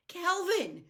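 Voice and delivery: high, loud desperate call for help; gasped cry. Acted. Meg calling Calvin for help, high desperate cry. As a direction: cry out to him in panic. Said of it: "she gasped".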